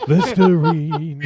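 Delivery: stretched out slowly through laughter; sing-say the brand name while cracking up; laughing jingle. Pretend. Friend cracking up at a misheard song, laughing.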